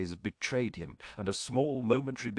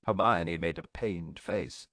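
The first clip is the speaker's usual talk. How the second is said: ordinary delivery, nothing marked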